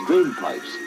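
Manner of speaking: ordinary delivery, nothing marked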